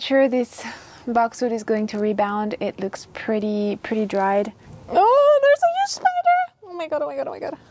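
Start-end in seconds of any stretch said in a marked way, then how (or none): 4.9-6.4 high, loud startled shriek at a spider. Real, not acted. Sudden spider discovery. 6.6-7.6 high, breathy panicked oh my god; fading into frightened hush; panicked whisper-gasp. Real, not acted. Spider still nearby.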